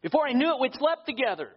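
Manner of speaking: high, loud sarcastic repeat; scornful singsong parody of a lame excuse; mocking echo.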